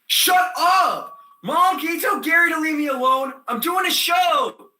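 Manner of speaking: high, whiny shout for Mom; exasperated yell. Acted. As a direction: holler a complaint across the house. Yells to Mom about Gary interrupting.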